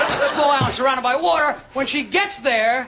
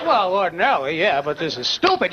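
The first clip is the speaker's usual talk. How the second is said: much lower, deadpan filmmaker explaining; mock-patient movie-makers' answer; 7 semitones lower than usual. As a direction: drop low and state the obvious. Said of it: "And they go".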